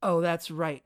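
ordinary delivery

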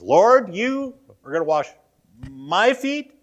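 high, loud stunned question, rise on my; emphatic disbelief. Retold or imitated. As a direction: push back in disbelief. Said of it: "he is emphatic about that".

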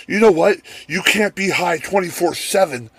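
high-pitched imitation of a defiant addict; retold comeback with a lifted voice; defiant parting shot. Imitates twacked-out man's parting shot.

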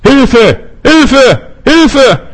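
high, loud desperate cries for help; crying for help. Retold or imitated. Preacher voicing the hidden cries of the lost, high desperate shouting. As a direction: shout "Hilfe!" like a drowning soul. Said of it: "da brüllen sie".

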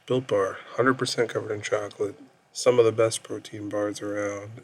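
smooth sponsor ad read. Acted. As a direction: read it like a commercial spot. Host reads sponsor ad.

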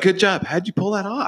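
high, hammed-up proud cheer; cheering parent voice. Family cheering on a bank robbery.

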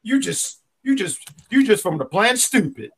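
imitating the cohost's Kentucky heckler voice; mock Kentucky accent. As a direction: put on a Kentucky twang to insult. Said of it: "What was that accent? Kentucky?".

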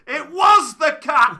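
high, very loud drawn-out yell; retold yell. Friend discovering the run-over cat, horrified yell. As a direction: yell it in horror, slow and high. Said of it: "He yells".

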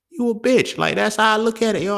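very high, loud scornful jab; contemptuous verdict. Real, not acted. Judging men who want protection, high loud contempt. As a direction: spit the insult out with scorn.

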